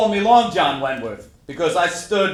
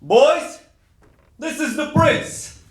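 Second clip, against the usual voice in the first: calling out to crowd; 9 semitones higher, slower than usual. Retold or imitated. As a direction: proclaim it up to the crowd below from a balcony, pitched high. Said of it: "I simply said".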